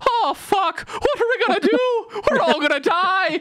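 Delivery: very high-pitched Irish villagers panicking; comic high-voiced despair; mock panic. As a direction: squeal it like a hammed-up doomsday crowd. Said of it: "potatoes go, get sick, and they're just like".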